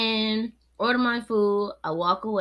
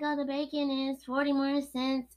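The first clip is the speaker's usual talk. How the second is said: mock cashier answer; 7 semitones higher, 5 dB quieter than usual.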